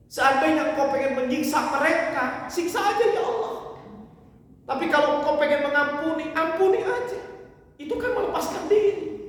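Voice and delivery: high, loud pleading to God; impassioned plea. Acted. Preacher voicing Isa pleading loudly to God for his people. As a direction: rise up into a crying appeal.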